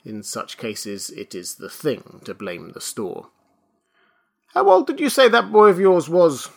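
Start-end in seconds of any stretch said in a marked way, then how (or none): 4.5-6.5 high, loud suspicious question; demanding. A narrator's reading. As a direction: demand an answer. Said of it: "demanded the".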